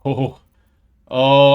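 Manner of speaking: ordinary talk, nothing marked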